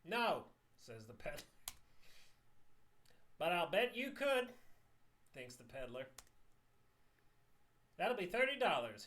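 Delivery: high and loud salesman; high, loud peddler voice; hammy peddler voice. Acted. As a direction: oversell like a street hawker.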